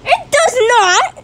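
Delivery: high, slow-stretched contradiction; indignant denial. Real, not acted. Child defending Minecraft to sister, indignant. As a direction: push back hard, stretching the words. Siblings quarrel over the game.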